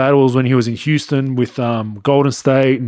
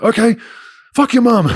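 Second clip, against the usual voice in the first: imitating Salah's snapping retort; mock defiant retort; 8 semitones higher than usual. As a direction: toss it back with a shrug then sting. Salah finally fires back.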